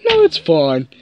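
ordinary talk

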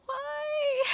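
very high, loud "why"; crying wail. Real, not acted. Reader made to cry by the author, wailing. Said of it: "going to cry like this".

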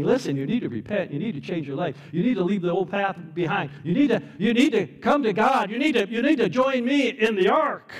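urgent preacher's plea, building to high and loud; proclaiming. Acted. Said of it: "He was proclaiming".

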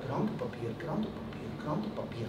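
very soft, hushed repetition; fading echo. Acted. Word spreading round the classroom, hushed echo. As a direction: repeat it softly like an echo. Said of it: "ging als een echo door de klas".

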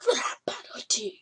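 breathy whisper, no voice. Shown as a demonstration. Gamer whispering the level name to viewers. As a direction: whisper the title like a secret.

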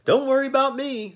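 very high, bright, cheery Jack voice; pleasant Pumpkinhead. A narrator's reading. Jack cheerfully reassuring the ferryman, very high and pleasant. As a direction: sing it out, untroubled and friendly. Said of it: "smiling pleasantly".

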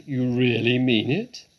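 booming disbelief, swooping up at the end; astonished cry. A narrator's reading. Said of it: "cried Badger".

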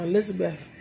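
normal talking voice

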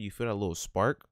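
ordinary talk, nothing marked

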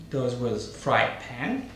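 usual speaking voice

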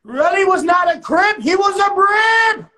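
high-pitched, loud proclamation, last word drawn out; emphatic shout. Acted. Storyteller declaring Relly was no Crip, emphatic shout. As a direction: shout it out for all to hear.

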